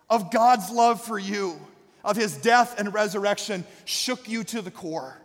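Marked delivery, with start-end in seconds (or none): none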